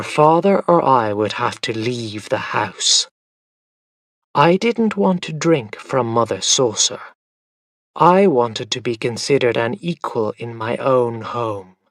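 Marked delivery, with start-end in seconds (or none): none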